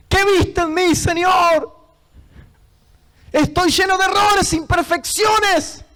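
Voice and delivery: high, impassioned shout; fervent, pleading preacher's cry; anguished cry to God. Retold or imitated. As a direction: cry out in disbelief at being chosen. Crying out his question to God.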